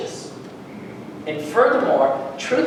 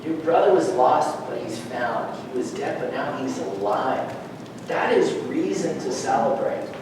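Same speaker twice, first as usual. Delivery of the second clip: low, softened fatherly explanation; a hint of a calm, reasoning father; lightly voiced father; 6 semitones lower, 6 dB quieter than usual. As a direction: gently give a son perspective, voice dropping. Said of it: "The father gave him perspective".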